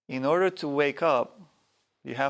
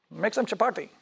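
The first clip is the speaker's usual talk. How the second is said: higher, foreign-sounding guru voice; guru's command; 8 semitones higher than usual. Retold or imitated. Guru waking at night to demand chapati, higher put-on voice. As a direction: give the order like an old Indian guru.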